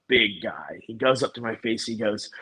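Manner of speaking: ordinary talk, nothing marked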